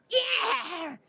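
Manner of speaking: high-pitched snarling cat cry; angry cat yowl. Acted. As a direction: yowl like a cat about to scratch. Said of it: "He goes".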